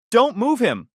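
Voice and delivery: high, loud command; urgent shout. Acted. Narrator shouts at wife not to move injured brother. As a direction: snap a warning.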